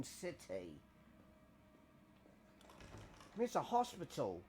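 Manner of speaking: hammed-up British accent, mate and innit. Gamer joking about bloody monsters in a hospital, mock British accent. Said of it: "It's a wicked accent, mate, innit?".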